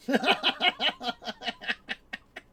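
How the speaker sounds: high giggling laugh; high breathless laugh; high burst of laughter. Real, not acted. Laughing at the memory.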